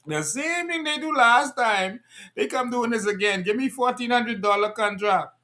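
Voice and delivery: lightly mock the complaining man; imitating the man in the voice note. Speaker mimics man from voice note, mock high voice. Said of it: "in the voice note".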